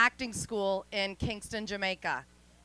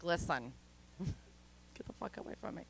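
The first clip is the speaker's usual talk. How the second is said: hushed threat; 3 semitones lower, 13 dB quieter than usual. Retold or imitated. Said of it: "I'd be like".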